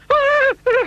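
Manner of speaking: squeaky panicked cry; a frightened little squeal; high nervous yelp. A narrator's reading. Mister Jelly panics.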